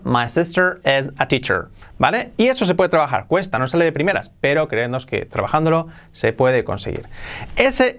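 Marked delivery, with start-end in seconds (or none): 0.0-1.7 English-native vowels and r; native English accent. Shown as a demonstration. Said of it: "Mucho mejor".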